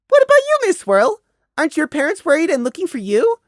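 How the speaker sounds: very high, curious little voice; innocent-seeming child. Acted. Prey slyly asking the mare about her parents, innocent child voice. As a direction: ask with feigned childish innocence. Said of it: "the innocent-seeming question".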